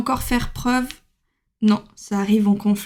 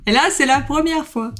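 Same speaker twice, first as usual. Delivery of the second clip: put-on English accent; 5 semitones higher than usual. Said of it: "mon magnifique accent anglais".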